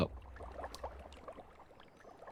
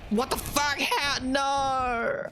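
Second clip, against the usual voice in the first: high squealing disbelief, rising to a shrill no; shocked squeal; much slower than usual. Real, not acted. Gamer yelping at a sudden game twist, shocked and high. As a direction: yelp in outraged surprise.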